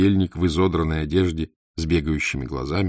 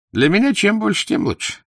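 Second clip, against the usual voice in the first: eager haggling; 5 dB louder than usual. Acted. Idler haggles for more pay.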